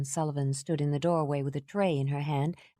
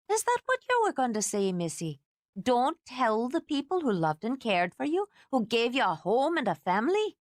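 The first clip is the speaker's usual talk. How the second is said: higher, building scolding lecture; lecturing housekeeper; 7 semitones higher than usual. A narrator's reading. Housekeeper lecturing Kate about worried family, rising and scolding. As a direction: pile on the guilt.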